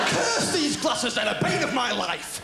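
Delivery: slow, high, hammed-up lament; melodramatic curse. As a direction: curse the glasses like a stage villain.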